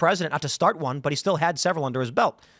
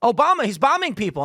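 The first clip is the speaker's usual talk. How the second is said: higher, louder exasperated repeat; exasperated insistence; 7 semitones higher, 4 dB louder than usual. Retold or imitated. Repeating the news to friends, exasperated and higher. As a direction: spell it out in frustration. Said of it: "Not protest what?".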